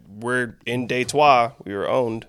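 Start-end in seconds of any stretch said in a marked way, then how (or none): 0.6-1.5 Detroit said as "day-twah"; Detroit slowly, ending in a French "wah"; French pronunciation. Shown as a demonstration. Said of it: "it got named at".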